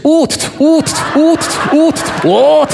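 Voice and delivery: high shouted 'oot' camera clicks; squeaky mock shutter sounds; camera noise imitation. Imitating tourists snapping photos.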